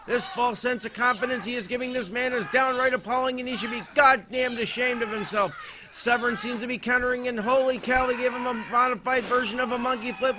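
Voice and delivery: ordinary talk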